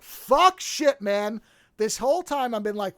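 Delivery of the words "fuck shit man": stunned curse. Retold or imitated. Retold reaction to twist.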